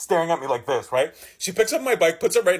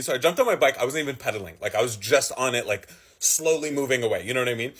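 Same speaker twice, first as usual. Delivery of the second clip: normal talking voice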